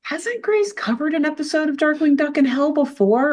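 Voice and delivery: high-pitched puzzled question to oneself; puzzled inner thought. Retold or imitated. Quotes own puzzled thought.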